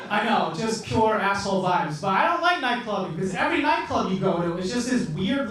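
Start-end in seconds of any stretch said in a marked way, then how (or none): none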